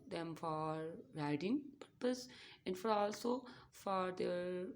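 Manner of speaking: ordinary talk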